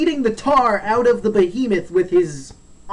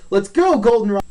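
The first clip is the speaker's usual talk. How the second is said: acted urgent call; 2 semitones higher, 5 dB louder than usual. Retold call while fleeing.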